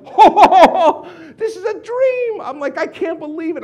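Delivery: high whoop sliding into a giddy squeal; overjoyed disbelief. Retold or imitated. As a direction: squeal as if living a dream. Thirty-five new people turned up.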